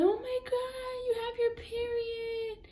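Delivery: very high, drawn-out mock whimper; imitated crying aunt. Aunt cries over her niece's first period, imitated crying. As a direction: whimper it slowly like a tearful aunt. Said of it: "she's over there crying".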